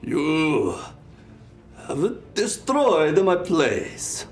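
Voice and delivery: high, drawn-out lament; screaming. Acted. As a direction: wail it slowly in a muddled put-on accent. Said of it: "he's screaming".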